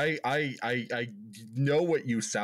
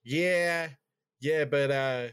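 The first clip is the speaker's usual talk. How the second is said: higher, drawn-out Boston auntie; put-on thick Boston accent; aunt's Boston accent; 5 semitones higher, much slower than usual. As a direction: imitate a Boston aunt complaining. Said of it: "my Aunt Diane goes".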